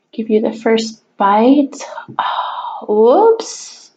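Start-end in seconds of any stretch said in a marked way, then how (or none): 2.2-3.9 whispered whoops. Real, not acted. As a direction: whisper the slip under your breath. Quiet slip while eating.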